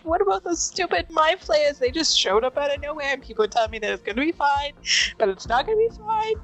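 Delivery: high, rising distraught sob-talk, pitch climbing to a break; sobbing. Acted. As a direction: play it as a panicked, weeping outburst. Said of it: "she just sobs in the ugliest crier".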